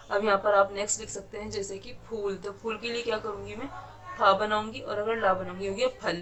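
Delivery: ordinary talk, nothing marked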